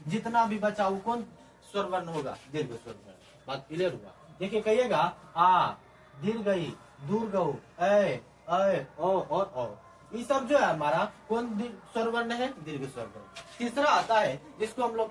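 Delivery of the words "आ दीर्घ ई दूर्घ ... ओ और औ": आ, ई, उ, ऐ, ओ, औ each held long as a separate item; long vowels. Shown as a demonstration. Teacher listing दीर्घ स्वर, आ to औ each held long. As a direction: recite आ, ई, उ, ऐ, ओ, औ with drawn-out vowels. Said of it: "दो मात्रा का समय लगता है".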